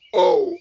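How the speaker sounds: loud high cry of effort; loud strained oh. A narrator's reading. Pooh straining as friends pull, loud cry. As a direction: cry out as you're yanked. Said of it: "Pooh said only".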